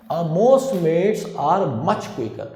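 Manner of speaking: slow, deliberate reading of the sentence. Shown as a demonstration. Teacher reading the correct sentence, very slow. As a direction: spell it out slowly for the students. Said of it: "reads option sentence slowly".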